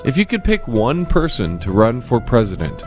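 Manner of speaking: ordinary talk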